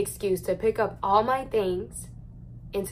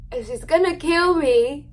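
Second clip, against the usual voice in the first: panicked outburst; 6 semitones higher, 7 dB louder than usual. Retold or imitated. Telling viewers mom will kill her, panicked. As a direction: pitch jumps up and louder, half laughing in dread. Fearing mom's reaction, voice rises.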